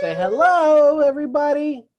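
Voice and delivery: put-on high, loud sing-song to a baby; baby talk. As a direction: coax a baby to say hello. Prompting the baby to greet viewers.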